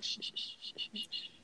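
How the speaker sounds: long whispered shushes; imitated repeated soft hushing; shushing. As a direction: hush someone over and over. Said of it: "he continues to shush me".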